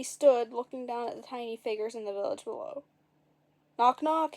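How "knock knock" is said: loud call of knock knock; shouted. A narrator's reading. Boy calling down to the village, shouting. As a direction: call it out down the hill. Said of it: "he shouted".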